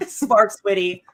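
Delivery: ordinary talk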